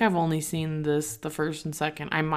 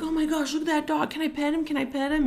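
mock gushing, much higher voice; gushing dog lovers; 11 semitones higher, faster than usual. Strangers crowding the dog, mocked in a much higher gushing voice. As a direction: squeal it like strangers cooing over a dog. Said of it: "everybody's like".

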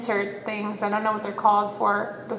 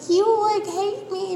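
mock sad villager; 11 semitones higher than usual. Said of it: "your animals are like".

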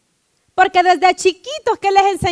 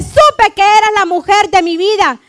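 loud, emphatic husband's declaration; demonstrated love declaration; 3 semitones higher, 6 dB louder than usual. Shown as a demonstration. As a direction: proclaim it to your wife with force. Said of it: "Usted dígale". (declaración de amor proclamada con fuerza)

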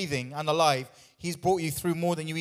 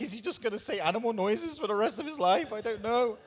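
worried wife voice; 6 semitones higher than usual. Retold or imitated. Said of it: "she's like".

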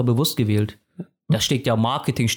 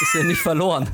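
laughing; 7 semitones higher than usual. Real, not acted. Laughing at his friend.

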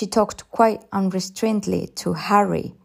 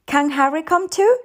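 put-on high child's question, rising on "too"; small girl asking hopefully; pleading little girl; 10 semitones higher, 4 dB louder than usual. A narrator's reading. Child begs for Harry to come.

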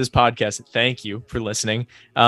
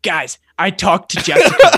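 louder, higher, laughing; excited laughing boast; 5 semitones higher, 7 dB louder than usual. Retold or imitated. Cohost retelling a movie intro, laughing excited boast. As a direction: gush it out proudly through a laugh. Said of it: "he's like".

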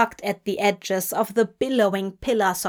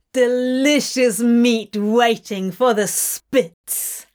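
gloating demon; 2 semitones higher, 4 dB louder than usual. A narrator's reading. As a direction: relish every threat like a demon. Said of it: "Crowley laughed".

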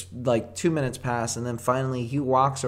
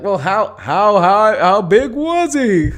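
high, loud hammed-up question; mock audience shout; 8 semitones higher, 11 dB louder, much slower than usual. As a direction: draw out the question in a big mock holler. Acts out an audience shouting a cue.

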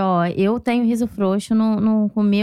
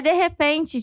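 ordinary delivery

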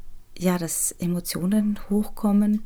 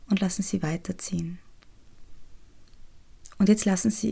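soothing spa whisper. Acted. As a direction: whisper them gently into calm. Leads the inner child meditation.

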